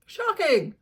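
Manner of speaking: mock shocked; sarcastic shock. Real, not acted. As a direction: fake gasp of shock.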